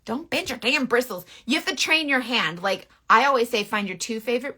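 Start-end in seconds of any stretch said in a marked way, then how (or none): none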